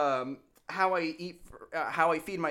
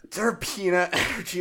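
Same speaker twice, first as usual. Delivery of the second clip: louder, delighted echo of the phrase; excited echo; 5 semitones higher, 5 dB louder than usual. Real, not acted. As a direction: repeat it back with glee. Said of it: "Holy fuck".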